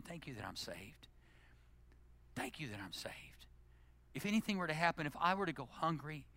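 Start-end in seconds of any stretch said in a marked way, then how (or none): 0.0-1.1 much softer, low and intimate; soft prayer. Acted. Private prayer of thanks. 2.3-3.5 whispered prayer. Acted.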